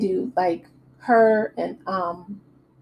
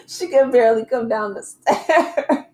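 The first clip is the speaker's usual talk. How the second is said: laughing; 4 semitones higher than usual. Real, not acted. Laughing at Ashley's gown.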